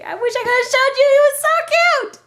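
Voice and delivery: high, loud and quick gushing. Real, not acted. Gushing over baby.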